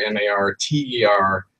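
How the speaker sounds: normal talking voice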